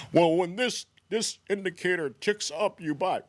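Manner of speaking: a hint of a clueless newbie's voice; mock naive trader. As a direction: play a beginner proudly explaining his indicator. Imitating a naive trader's explanation.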